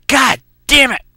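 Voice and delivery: high-pitched, punched-out curse; acted-out exasperated outburst; pissed off. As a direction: curse in sheer frustration. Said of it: "That kinda pissed me off".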